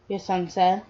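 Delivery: plain reading voice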